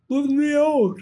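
high, desperate little cry; panicked plea; trapped, pleading. Acted. Gus trapped in jar.

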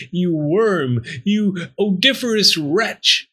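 high, forceful storybook insults; reviling sneer. A narrator's reading. Talking bone reviling the fox, sneering. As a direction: hurl insults, climbing in pitch. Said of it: "commenced to revile the fox".

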